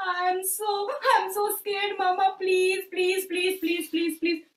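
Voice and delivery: put-on high childlike dragon voice pleading with mama; a little dragon near tears calling mama; scared baby dragon. Frightened dragon begs mama, high pleading voice.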